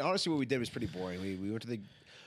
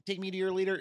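high alien voice; 9 semitones higher, 4 dB louder, faster than usual. Pretend. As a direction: demand it like a visiting alien. Classic alien line.

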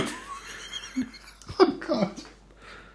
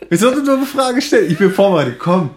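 defiant; 6 semitones higher, 5 dB louder than usual. Real, not acted. Challenging friend after a quiz.

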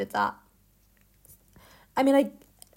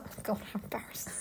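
fast, very soft mutter; whispered mock embarrassment; 18 dB quieter, faster than usual. Friend voicing Peter caught with dirty sword, whispered embarrassment. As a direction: whisper it quickly, as if mortified. Peter's acted mortified reaction.

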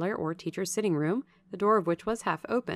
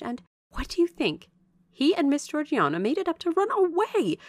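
animated servant's gossip, pitched up and swooping; gossipy Bessie, relishing the news; 6 semitones higher, 3 dB louder than usual. Acted. Bessie telling Jane the news of Gateshead, eager gossip. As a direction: dish out the family scandal with lively emphasis.

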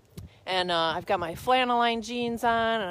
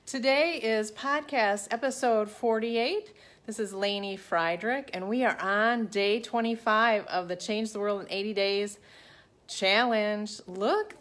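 usual speaking voice

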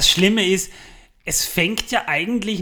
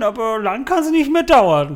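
louder, higher teasing declaration; teasing boast; 7 semitones higher, 8 dB louder than usual. Real, not acted. Host teases co-host about AI replacing him, louder and higher.

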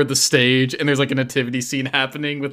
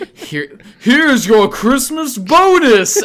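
slow, high, booming announcement; accidental loud call; 9 semitones higher, 6 dB louder, slower than usual. Retold or imitated. The wind carries the bonus line, a slow loud call. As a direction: it carries on the wind, much louder than meant. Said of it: "you say it louder than you expect".

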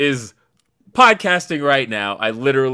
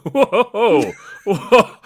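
amused laughing whoa, louder; 6 semitones higher, 6 dB louder than usual. Retold or imitated. To his friend announcing an eight-day stay, laughing. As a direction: laugh through the whoas.

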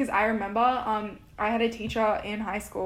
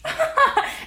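high burst of laughter; 5 dB louder than usual. Real, not acted. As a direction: laugh out loud. Friend laughs at rant.

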